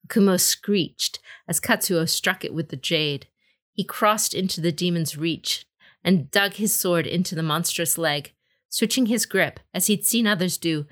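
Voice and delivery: ordinary delivery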